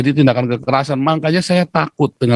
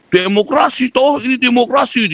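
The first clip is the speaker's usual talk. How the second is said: mocking quote; 10 semitones higher than usual. Guest mocks a critic for host. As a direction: sneer it out like a hypocrite. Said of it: "dia bilang gitu".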